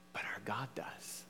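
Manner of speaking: near-whispered, low reply; hushed assurance. Real, not acted. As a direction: drop to a whisper for the answer. Hushed answer after raised build-up.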